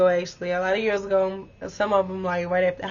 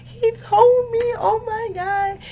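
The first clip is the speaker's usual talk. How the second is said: excited squeal; 12 semitones higher, much slower than usual. Retold or imitated. Retold excited phone quote.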